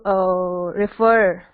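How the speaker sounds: ordinary delivery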